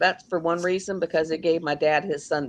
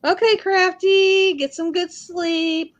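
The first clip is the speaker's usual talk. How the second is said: high, slow sing-song goodbye; high sing-song goodnight to a viewer; high farewell; 10 semitones higher, slower than usual. Real, not acted. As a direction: draw out a high sweet sign-off.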